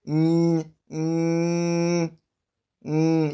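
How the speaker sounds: long, level hummed "Mm" through the nose; NY nasal sound. Shown as a demonstration. Teacher demonstrating the NY digraph, held nasal hum. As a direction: hold "Mm" steady with the mouth passage blocked. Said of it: "give you the sound like this".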